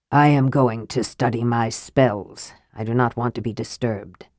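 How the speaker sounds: low, quiet mage voice; curt mage. A narrator's reading.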